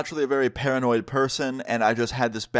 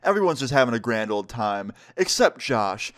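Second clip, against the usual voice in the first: ordinary delivery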